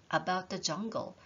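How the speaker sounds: usual speaking voice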